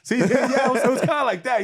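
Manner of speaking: high, loud laughing aside. Real, not acted. Storyteller cracks up to the host, high and loud. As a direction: crack up at yourself.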